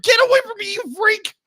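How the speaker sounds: high, loud mock shriek; mock frightened yell. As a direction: act out a girl yelling off a creep. Voicing Katie fleeing creepy Nightcrawler.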